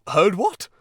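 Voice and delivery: high, loud startled question; alarmed Toad. A narrator's reading. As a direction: blurt it in sudden alarm. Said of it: "turning rather pale".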